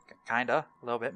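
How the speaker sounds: ordinary delivery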